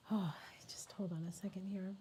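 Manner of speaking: choked, whispered plea for a moment; tearful whisper. Real, not acted. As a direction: whisper it while holding back tears. Fighting tears mid livestream.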